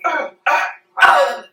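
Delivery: sharp high yelps of pain; short, high, loud grunts of hurt; pained cries. Acted. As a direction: cry out as if stabbed. Acts man being stabbed in arm.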